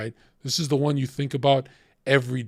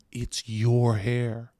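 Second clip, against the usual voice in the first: drawn-out retort; much slower than usual. Retold or imitated. Triumphant retort in retold quarrel.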